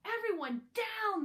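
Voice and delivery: high, loud urgent command; teacher's shout. Acted. Teacher to the class during gunfire, a sharp high shout. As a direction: bark the order to hit the floor. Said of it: "the teacher shouted".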